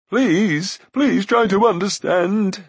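high, loud, drawn-out begging; urgent high plea; pleading Glue. A narrator's reading. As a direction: implore in a whiny wail. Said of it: "returned Glue's voice".

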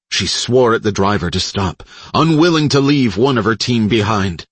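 ordinary delivery, nothing marked

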